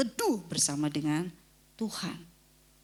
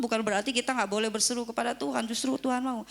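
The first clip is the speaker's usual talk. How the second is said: ordinary delivery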